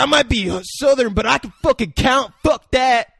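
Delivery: loud, high Southern protest; Southern accent. Acted. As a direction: holler it like an indignant Southerner. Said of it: "the one, like, Southern dude's like".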